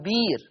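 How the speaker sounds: "بير" with a long ee, no glottal stop; demonstrated "بير", hamza softened to ee; hamza becomes yaa. Shown as a demonstration. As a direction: say "بير" smoothly, vowel held. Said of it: "هكذا".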